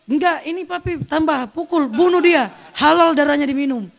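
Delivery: high, forceful egging-on; retold vicious urging. Mother urging father to beat.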